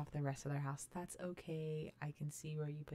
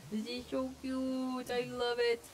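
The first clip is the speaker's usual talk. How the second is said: high, drawn-out delight, getting louder; gushing; 4 semitones higher, 5 dB louder, slower than usual. Real, not acted. Gamer adoring the island decor, gushing. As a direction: coo it slowly, then burst out louder.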